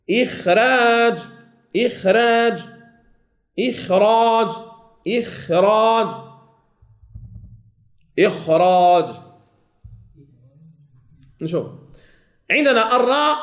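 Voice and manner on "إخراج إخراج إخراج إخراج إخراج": إخراج said slowly, testing the heavy and light خ; trying both readings. Shown as a demonstration. Teacher testing both readings of إخراج aloud, slow and careful. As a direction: try إخراج over and over, comparing the two ways. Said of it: "أنا نجربهم بجوج".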